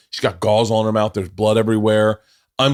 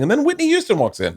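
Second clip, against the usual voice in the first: mock dramatic reveal; 13 semitones higher than usual. Mock version of wife's story.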